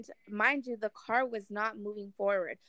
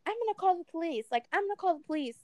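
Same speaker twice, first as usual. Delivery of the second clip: higher-pitched imitation of an indignant older woman; mock threat; 9 semitones higher, much faster than usual. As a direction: threaten it in a raised-pitch mocking voice. Acts out gatekeeper threatening police.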